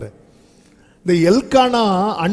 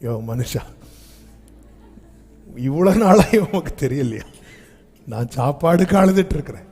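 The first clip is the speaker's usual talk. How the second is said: Hannah's reproach; 3 semitones lower than usual. Retold or imitated. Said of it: "அழுதுட்டே பாக்குறா".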